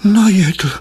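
high moaned question; wife's high, disbelieving groan; moaning. A narrator's reading. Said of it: "sténala manželka".

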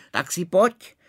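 high, loud proud dare; defiant challenge. Acted. Former suitor proudly daring the Maharaja, loud. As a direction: call him out to fight.

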